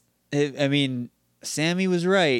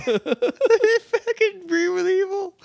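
incredulous joke; much slower than usual. Real, not acted. Joking guess at villain voice.

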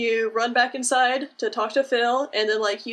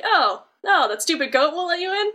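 mock sympathetic Hades; 6 semitones higher than usual. Hades sweet-talking Sora.

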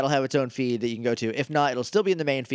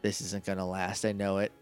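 low, very soft murmur to a pet; quiet petting talk; 7 semitones lower, 10 dB quieter than usual. Acted. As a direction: speak softly while stroking an animal. Murmurs while petting the Pokémon.

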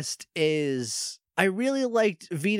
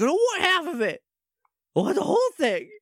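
high-pitched mock tantrum; hammed-up petulant whine; mad, bratty villain; 11 semitones higher, faster than usual. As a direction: refuse like a spoiled little kid, voice shooting up. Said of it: "and he's mad about it".

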